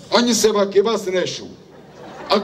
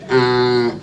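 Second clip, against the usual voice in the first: usual speaking voice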